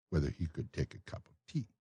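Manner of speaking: usual speaking voice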